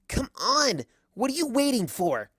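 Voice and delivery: high, urgent taunt; goading challenge. Acted. Twilight to Celestia, urgent goading. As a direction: push her to act now.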